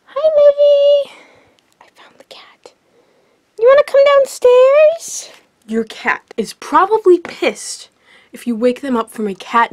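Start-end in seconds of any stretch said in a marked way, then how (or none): 0.1-1.1 high squeaky pet voice; sing-song high greeting to a pet. Real, not acted. Baby talk greeting to cat. 1.8-2.7 hushed whisper so the cat won't wake; sneaky secret whisper. Real, not acted. 3.6-5.3 very high coaxing pet voice; high sing-song question to a cat. Real, not acted.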